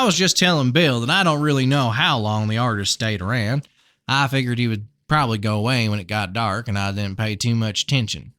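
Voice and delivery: put-on folksy Southern-accented farmer; amateur Southern twang. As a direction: chat like a friendly Southern neighbour. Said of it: "really bad Southern accent".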